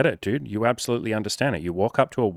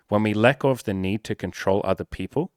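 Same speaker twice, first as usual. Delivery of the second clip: ordinary talk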